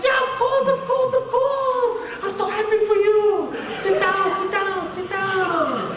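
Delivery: put-on very high, sing-song excited Chinese-accented lady; stretched-out, overjoyed Chinese accent; thick Chinese accent. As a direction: gush like a thrilled Chinese examiner. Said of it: "she had a really thick Chinese accent".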